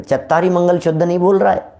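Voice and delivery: ordinary talk, nothing marked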